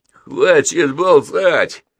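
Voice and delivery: high, loud, drawn-out order; barked shout. A narrator's reading. Gang leader firing at the boy, barking. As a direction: bark it out menacingly. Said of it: "гаркнул Папст".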